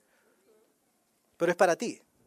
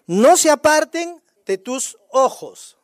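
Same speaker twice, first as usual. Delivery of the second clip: slow, emphatic verse reading, loud; lectura enfática; 3 semitones higher, 5 dB louder, slower than usual. Real, not acted. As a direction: declare each word slowly and loudly. Lectura enfática del versículo. (lectura lenta, fuerte y enfática)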